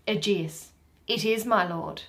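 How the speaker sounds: usual speaking voice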